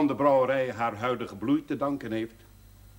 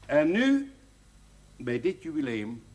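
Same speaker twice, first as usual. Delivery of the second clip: normal talking voice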